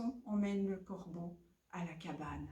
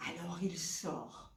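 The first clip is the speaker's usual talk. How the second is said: ordinary delivery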